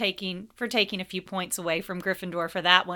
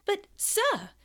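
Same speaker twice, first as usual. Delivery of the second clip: high, pleading protest; eager Hermione; 12 semitones higher than usual. A narrator's reading. Hermione protesting to Snape, high eager voice. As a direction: burst out, pitched high. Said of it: "seemingly unable to restrain herself".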